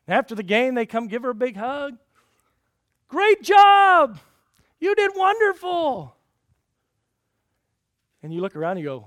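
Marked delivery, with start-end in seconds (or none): 3.1-6.2 much higher, loud, slow gushing; gushing praise. Retold or imitated. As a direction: praise her like a proud parent. Parents praising after game.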